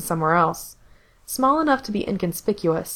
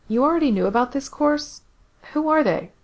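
higher, startled questions; Gerard surprised; 8 semitones higher than usual. Acted. Gerard questions Corse about what he knew, higher and surprised. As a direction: catch him out, pitched up.

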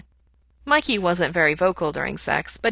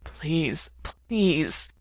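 breathless begging. A narrator's reading. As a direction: beg it out of breath. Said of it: "breathlessly saying".

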